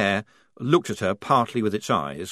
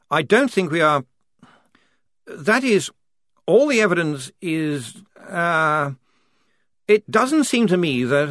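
halting, higher officer voice; hesitant officer; 7 semitones higher, 4 dB louder than usual. A narrator's reading. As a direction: struggle to get the words out.

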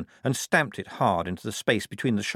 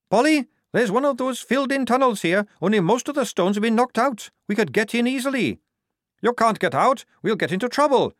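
much higher, louder tomboy voice; tough girl soldier; 13 semitones higher, 5 dB louder than usual. A narrator's reading. Tonka calling down to Polly about the tunnel, high and loud. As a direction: report the tunnel eagerly.

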